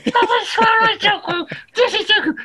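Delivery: exasperated shout. Retold or imitated. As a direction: bellow it in disbelief. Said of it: "the guy's like".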